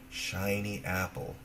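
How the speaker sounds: ordinary delivery, nothing marked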